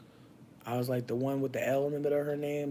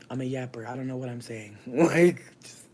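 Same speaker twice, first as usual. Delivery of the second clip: ordinary talk, nothing marked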